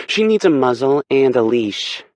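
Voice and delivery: high, loud, sharp snap; angry snap. Acted. Aspen snaps at Kay about Hurricane, loud and sharp. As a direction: snap the order at him. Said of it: "Aspen snaps".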